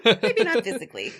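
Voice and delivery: laughing through the words. Real, not acted. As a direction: giggle it out. Laughing at own joke.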